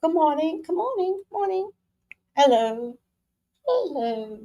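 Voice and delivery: high, airy put-on office greeting; corporate office voice. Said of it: "I have to talk like this".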